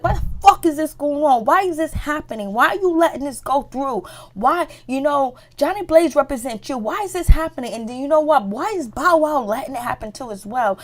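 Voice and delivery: high, loud, exasperated demands; upset outburst. Retold or imitated. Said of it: "The Brat is so upset".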